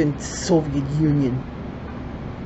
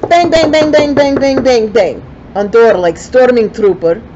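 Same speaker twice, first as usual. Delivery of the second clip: banging knock sounds; 6 semitones higher, 5 dB louder than usual. Retold or imitated. Imitating banging on the door.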